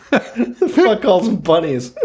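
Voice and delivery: slow, laughing disbelief; teasing a friend through real laughter. Real, not acted. Laughing while mocking the word.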